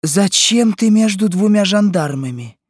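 high-pitched, astonished question; alarmed. A narrator's reading. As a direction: ask your daughter in shocked disbelief. Shocked mother questions arrested daughter. (встревоженно, высоким голосом)

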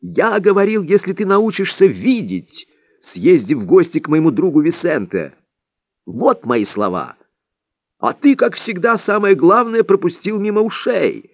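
high-pitched reproach, dipping midway; indignant. A narrator's reading. Don Juan scolding his pupil for missing the point, indignant. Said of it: "возмутился Дон Хуан".

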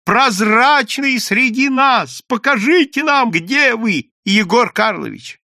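loud, high, slowed proclamation; shouting to the crowd. A narrator's reading. Ioann announcing to the crowd, loud and high. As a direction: bellow it across the square. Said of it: "крикнул Иоанна Польский".

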